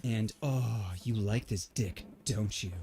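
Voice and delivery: deadpan dirty talk that sounds like a joke; lightly sarcastic, half-hearted seduction. Retold or imitated. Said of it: "everything I said came out sarcastic".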